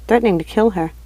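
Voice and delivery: ordinary delivery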